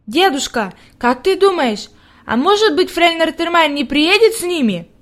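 put-on mildly embarrassed childlike question; hesitant little girl. A narrator's reading. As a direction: ask grandfather timidly, as a child. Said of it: "на лице её появилось смущение".

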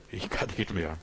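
very soft giving up; quiet despair. Retold or imitated. Preacher voices someone giving up, very softly. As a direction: drop to a hopeless murmur.

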